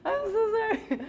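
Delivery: high, loud apology through laughter; laughing too hard to speak; real helpless laughter. Real, not acted. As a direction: squeeze the words out while cracking up. Apologising through her own laughing fit.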